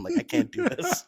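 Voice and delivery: high, whiny mock plea; mock whiny guest. Host imitating a guest begging to leave the room, high mock whine. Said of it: "and said".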